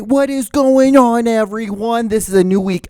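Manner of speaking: loud, high hype show opener; excited. Real, not acted. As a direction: pump up the audience.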